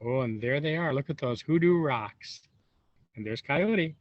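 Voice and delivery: ordinary delivery, nothing marked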